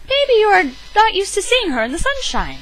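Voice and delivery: light high soothing wife. Acted. Bigfoot reassures Louie.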